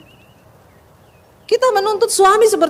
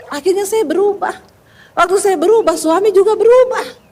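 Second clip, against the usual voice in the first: ordinary talk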